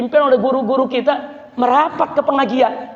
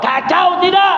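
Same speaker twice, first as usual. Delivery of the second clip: shouted question; 8 semitones higher, slower than usual. Acted. Preacher shouting the question back at the congregation. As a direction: hammer it at the crowd.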